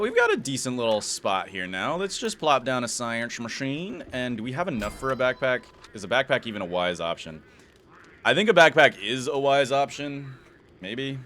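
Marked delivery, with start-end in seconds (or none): none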